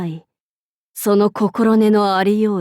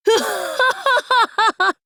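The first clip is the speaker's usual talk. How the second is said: evil cackle; 11 semitones higher than usual. Acted. Evil laugh in battle.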